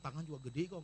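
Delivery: normal talking voice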